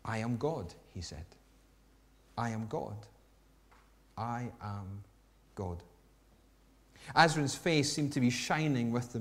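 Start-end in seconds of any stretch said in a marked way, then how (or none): 0.1-5.8 low, soft, slow and weighty; slow and solemn. Acted. Jesus tells Azrin slowly, softly: I am God. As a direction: let each word land slowly and softly. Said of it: "Then very slowly, he repeated".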